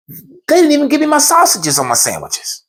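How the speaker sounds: high-pitched exasperated grievance; retold outraged protest; indignant complaint. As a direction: squeal the last straw at the manager.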